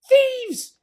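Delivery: high, loud squeaky shriek; Rat shrieking. Acted. Rat shrieks at the fleeing foxes, high and loud. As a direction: scream after the thieves. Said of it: "shrieked Rat".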